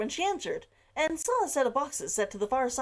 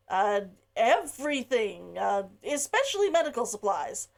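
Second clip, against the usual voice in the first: hesitant, halting. Acted. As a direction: stall nervously before the bad news. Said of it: "he paused".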